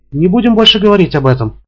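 high, firm refusal; woman ending the talk. A narrator's reading. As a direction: shut the subject down. (высоко и твёрдо)

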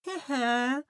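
high, soft villain chuckle of a word; self-satisfied little schemer; Krysion, gleeful. A narrator's reading. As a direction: gloat quietly in a high voice. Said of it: "потёр лапы Крысион".

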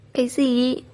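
a brief, mildly startled question; surprised exclamation. A narrator's reading. Trevi hears the accusation against Nim, mildly surprised. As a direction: blurt it out in understated surprise. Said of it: "Trevi ngạc nhiên thốt lên".

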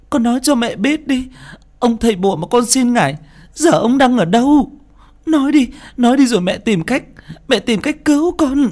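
desperate mother begging her son for answers; choked-up mother. Acted. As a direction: beg your son through tears to tell. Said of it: "Bà Đào nghẹn ngào".

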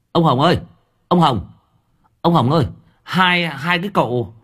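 high, loud urgent calls; calling out urgently. Acted. Villager runs in calling for old Hồng, loud and urgent. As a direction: shout for old Hồng from the gate.

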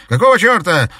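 furious yell, high and loud; angry shout. Acted. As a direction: snarl it out at the intruder. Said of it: "злобно выкрикнул он". (злобный выкрик)

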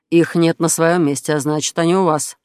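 plain reading voice

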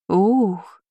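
long exhaled wow; mildly stunned exhalation; exhaled amazement. A narrator's reading. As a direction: breathe out a drawn "ooh". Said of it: "выдохнула Шарлотта".

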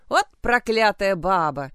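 ordinary delivery

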